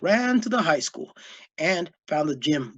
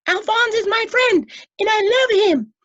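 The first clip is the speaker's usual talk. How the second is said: high, loud defiant cry; 8 dB louder than usual. A narrator's reading. Boy defending his friend, loud and high. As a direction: insist with all his heart. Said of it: "cried Lois".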